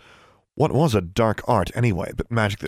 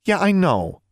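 dismissive; 9 semitones higher than usual. A narrator's reading. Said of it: "waved a hand dismissively".

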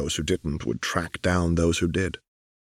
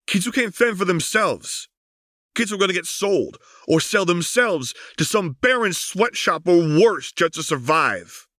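impassioned; 11 semitones higher than usual. Acted. Echo pleads for the kids.

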